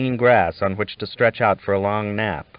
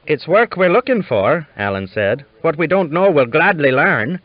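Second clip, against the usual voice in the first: lightly voiced young Irishman, higher and louder; eager Irish lad offering himself up; Irish boy asking rancher for work; 7 semitones higher, 6 dB louder than usual. A narrator's reading. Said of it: "These Irishmen have fine voices".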